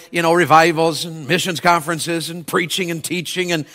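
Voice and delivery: ordinary delivery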